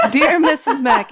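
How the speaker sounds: reading through laughter, high and loud; laughing. Real, not acted. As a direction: giggle while reading it out. Restarts reading the note after a laugh.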